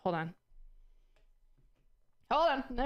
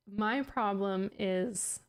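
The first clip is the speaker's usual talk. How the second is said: drawn out, word by word; slowed down; much slower than usual. Shown as a demonstration. Slowing down to avoid tripping over the word. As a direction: say it slowly, spacing it out. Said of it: "I have to say it slowly".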